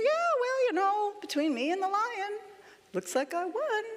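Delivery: high-pitched, nonchalant bragging; casual boast. Acted. Rabbit to the amazed animals, casually triumphant.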